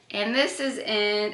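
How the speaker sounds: ordinary talk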